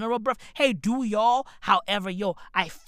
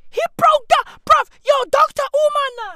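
much higher and louder, hyped exclamation; excited shout; 10 dB louder than usual. Real, not acted. Praising Doctor Umar to viewers, excited shout. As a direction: shout it out in amazement. Hyping up Doctor Umar's speech.

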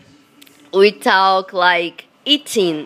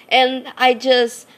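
ordinary talk, nothing marked

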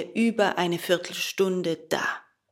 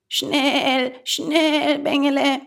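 high, loud, urgent goat voice; high-pitched goat calling out; bleating goat; 8 semitones higher, 6 dB louder, slower than usual. A narrator's reading. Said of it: "meckerte die Ziege".

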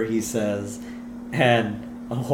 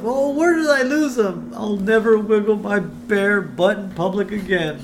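high, self-pitying moan; Homer lamenting; 9 semitones higher, 4 dB louder than usual. Acted. Comic brooding at home after disaster, high lamenting voice. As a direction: wail to yourself in mock regret. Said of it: "he's just thinking to himself".

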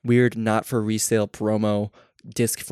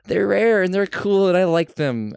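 excited; 9 semitones higher, 5 dB louder, slower than usual. Real, not acted. Gushing about rare consoles.

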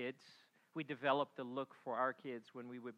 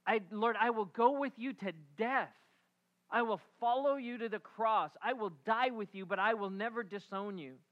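fervent promise; 6 semitones higher, 5 dB louder than usual. Acted. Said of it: "Here's Peter".

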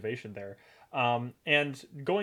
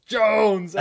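loud, much higher barked name, retold; furious yell; 8 semitones higher, 11 dB louder than usual. Boss Mac yelling for Jones backstage, angry shout. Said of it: "Mac comes in and yells".

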